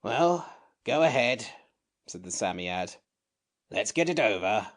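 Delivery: a cross little sand fairy; grumpy creature. A narrator's reading. Samiad voiced.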